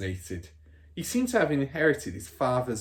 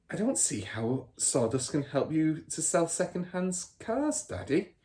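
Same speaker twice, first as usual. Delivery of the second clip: put-on light, higher little girl, innocently probing; Matilda's voice; 7 semitones higher than usual. Daughter questioning her father, lightly voiced girl. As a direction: ask like a curious child.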